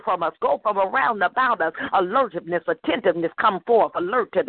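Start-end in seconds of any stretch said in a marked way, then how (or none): none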